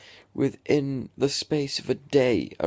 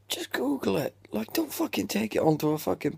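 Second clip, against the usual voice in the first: much higher, exasperated burst; 10 semitones higher, faster than usual. Real, not acted. Ranting at the girl online, exasperated high burst. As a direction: throw it out in disbelief.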